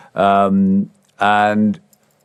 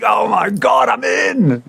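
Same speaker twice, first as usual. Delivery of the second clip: joyful shout; 13 semitones higher, slower than usual. Retold or imitated. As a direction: yell it out, you got the gig. Celebrating getting the gig.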